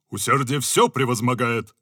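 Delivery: lightly voiced stubborn officer; a hint of a bold general. (лёгкая бравада генерала)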